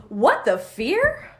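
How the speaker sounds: loud, drawn-out show title with rising end; hammy questioning title; game-show title call. Pretend. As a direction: announce it like a game-show host. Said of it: "Do you have to say it like that?".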